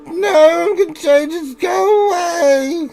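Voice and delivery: high, loud dragged-out shout; Dark Crystal lady voice. Acted. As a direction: shriek them away in a high voice. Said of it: "Sounds like the lady from the Dark Crystal".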